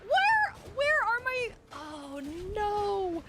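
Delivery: very high, whiny lament; high-pitched, crying complaint; distraught wail. Real, not acted. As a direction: wail it like a thwarted player. Losing in game, icicles gone.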